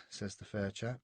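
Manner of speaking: ordinary delivery, nothing marked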